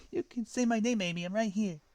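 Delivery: a mistaken high take; wrong voice, too high. Acted. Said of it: "said Shadow".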